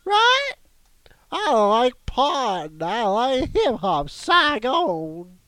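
high, slow squeaky tortoise shout; slow creaky tortoise. Pretend. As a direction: yell like an outraged old tortoise. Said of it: "That's my new Turtle voice".

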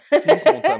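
loud high shout. Acted. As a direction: belt it out loud. Shouted repeat of the count. (grito alto)

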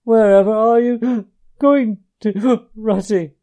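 high, loud cry between gasps; tearful cry. A narrator's reading. Mole to Ratty as he walks off, a tearful gasping cry. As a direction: gasp the question out in alarm. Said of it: "cried the tearful Mole".

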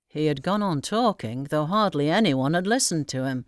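ordinary delivery

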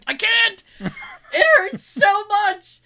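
high, loud laughing whine, drawn out; laughing pain. Retold or imitated. Telling the nurse her clenched arm hurts, laughing whine.

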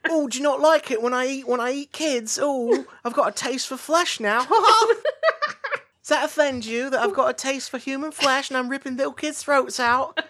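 high taunting voice, laughing through it; a werewolf played as a gleeful, giggling troll; Ricky Gervais. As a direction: needle the listener like a smug stand-up. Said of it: "he's just Ricky Gervais".

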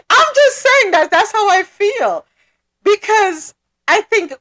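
high, loud laugh into the line. Real, not acted. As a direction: laugh it off while defending herself. Laughing after teasing reply.

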